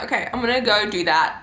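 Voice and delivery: ordinary talk, nothing marked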